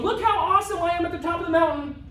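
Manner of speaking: high, sustained show-off call; mocking a show-off; mock boasting. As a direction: brag from the summit, hammed up.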